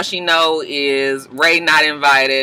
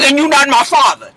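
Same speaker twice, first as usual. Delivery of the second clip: higher mock-indignant mother's voice; scolding Mama Dap; 8 semitones higher, 4 dB louder than usual. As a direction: put the son in his place, high and huffy. Mama Dap scolds her son.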